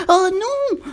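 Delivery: high, loud squeal of dismay; squealing piglet. A narrator's reading. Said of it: "couina le porcelet". (porcelet qui couine)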